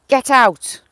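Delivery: sharp loud shout; real shout to shoo it out. Real, not acted. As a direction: chase the animal off with a yell. Shooing animal out of garden.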